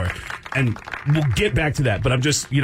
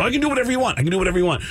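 eager; 6 semitones higher, much faster than usual. Retold or imitated. Eager actor answering casting call.

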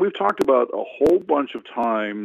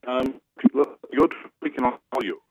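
breaking up on a bad phone line; choppy speakerphone audio; phone speaker; 3 semitones higher than usual. Real, not acted. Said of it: "you seem to be breaking up here a bit".

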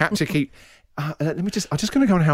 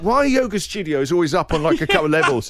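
ordinary delivery

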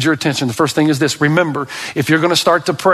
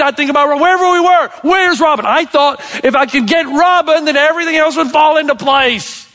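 fired-up preaching; 10 semitones higher than usual. Acted. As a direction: preach it with rising fire. Impassioned preaching, high and loud.

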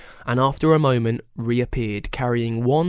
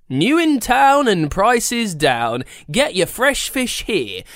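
market trader calling; 8 semitones higher, slower than usual. Acted. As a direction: hawk fish to passers-by. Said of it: "called out the man in the van".

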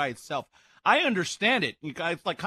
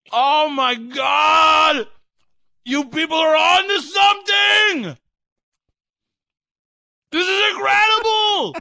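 high, loud thrilled exclamations, first one dragged out slowly; excited shout; 9 semitones higher, 9 dB louder, slower than usual. Real, not acted. Host raving to listeners about the mayo, excited shouting. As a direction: blown away by the taste, let it burst out. First taste of mayonnaise sandwich.

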